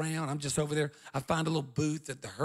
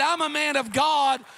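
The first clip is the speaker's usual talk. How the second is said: firm reassurance; 10 semitones higher, 7 dB louder, slower than usual. Retold or imitated. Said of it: "I told him, I said".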